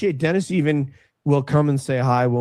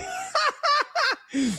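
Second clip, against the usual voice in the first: high laughing squeal; 5 dB quieter than usual. Real, not acted. Laughing at the joke.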